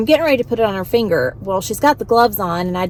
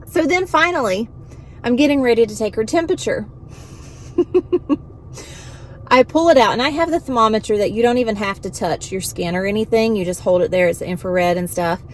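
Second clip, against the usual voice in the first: ordinary delivery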